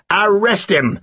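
contained commanding shout. A narrator's reading. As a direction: shout the order, not at full force. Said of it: "Snape shouted".

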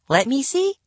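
put-on curious girl voice. A narrator's reading. Curious sister.

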